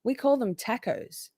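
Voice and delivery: tacos said with a flat short a as in tack; Australian tacos, a like in cat; Aussie taco. Shown as a demonstration. Explaining to chat, tacos with Aussie short a. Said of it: "T A C O is pronounced in Australia".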